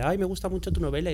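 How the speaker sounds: lightly acted gushing fan; imitated admiring reader. Voicing an admiring reader's message.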